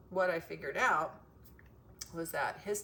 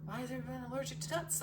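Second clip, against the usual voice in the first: quick, higher-pitched puzzled question; retold bewilderment; 6 semitones higher, 3 dB quieter, much faster than usual. Recalling everyone's reaction to nut allergies, bewildered. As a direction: ask it fast in a higher, puzzled voice. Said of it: "we're like".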